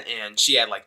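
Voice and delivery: normal talking voice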